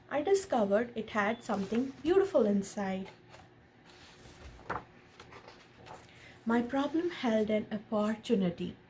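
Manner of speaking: gentle storybook read-aloud; lightly animated picture-book narration; reading to a child. A narrator's reading. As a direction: read it like a bedtime story to your son. Reads picture book to son.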